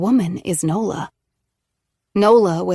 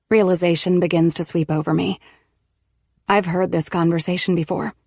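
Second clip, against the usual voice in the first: ordinary delivery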